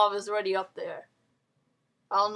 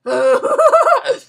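loud, high burst of laughter; big laugh; 13 semitones higher, 11 dB louder than usual. Real, not acted. Gamer cracking up in the washroom. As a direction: howl with laughter.

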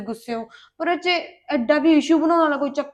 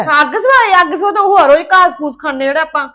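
scornful mockery; 8 dB louder than usual. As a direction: sneer at the pumpkin and lentils. Wife ridicules plain vegetable diet.

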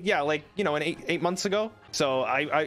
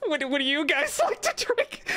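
laughing question; 11 semitones higher than usual. Real, not acted. Laughing while asking viewers.

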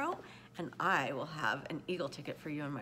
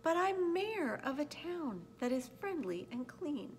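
high, lilting little Who; polite little voice from a dust speck; tiny mayor's voice; 7 semitones higher than usual. A narrator's reading. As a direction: speak as a very small, proud mayor, pitched up. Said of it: "called the voice".

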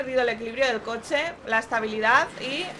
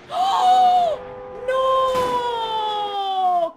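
high, loud startled shout; real dismay at a crash; shocked cry; 10 semitones higher, 5 dB louder than usual. Real, not acted. Reacting to a crash, a loud shocked cry.

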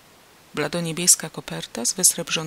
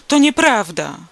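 higher, much louder furious denial; angry roar; 5 semitones higher, 10 dB louder than usual. A narrator's reading. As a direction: bellow the denial. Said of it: "ryknął Wilczur". (gniewny, głośny ryk)

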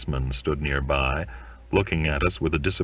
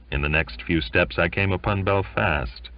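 plain reading voice

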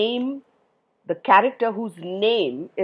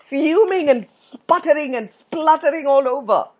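sputtering; 7 semitones higher, 5 dB louder than usual. Shown as a demonstration. Imitates Tuffy's sputtering rage.

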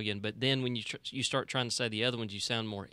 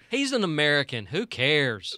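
louder mock shrug-off; dismissive local; 3 semitones higher, 6 dB louder than usual. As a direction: wave it off as the locals would. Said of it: "It's like".

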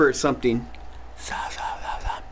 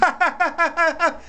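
high whinnying laugh like a horse; 10 semitones higher than usual. Acted. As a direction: let out a horsey laughing neigh. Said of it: "the horse starts laughing".

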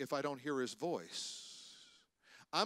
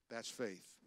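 low, soft statement; quiet conclusion; 3 semitones lower, 6 dB quieter than usual. Real, not acted. Preacher concluding his point on risk, said quietly. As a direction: drop it softly, almost to yourself. Same line quiet, then punched.

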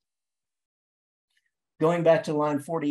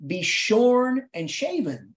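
slow, ringing poetic address; drawn-out, high-flown recitation; grand declamation; 3 semitones higher, 4 dB louder, much slower than usual. Acted. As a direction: declaim it grandly, stretching each word. Declaiming the poem to the bird.

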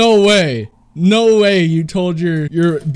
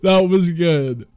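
usual speaking voice

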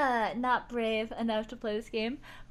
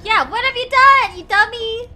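much louder, higher taunt; mocking scold; 9 semitones higher, 14 dB louder than usual. Real, not acted. Streamer mocking Lara for taking the dagger, loud and sarcastic.

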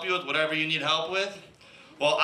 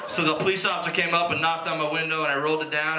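usual speaking voice

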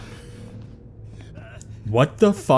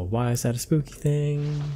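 normal talking voice

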